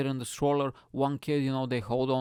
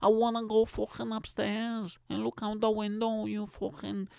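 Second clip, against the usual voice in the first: put-on high-pitched defiant child in thick Scouse; Scouse kid; 9 semitones higher than usual. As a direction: mouth off at mum as a Scouse brat. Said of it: "I don't usually do Scouse accent".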